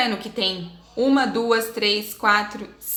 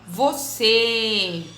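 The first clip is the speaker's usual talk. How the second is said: você with a long final CÊ; stressed syllable stretched. Shown as a demonstration. Teacher modelling circumflex words, você with CÊ drawn out slowly. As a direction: hold the closed ê ending você. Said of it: "a sílaba mais forte".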